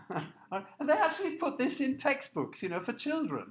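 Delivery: laughing, high-pitched incredulity; amused disbelief. Real, not acted. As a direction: let a laugh lift the words. Amused disbelief at racist textbook.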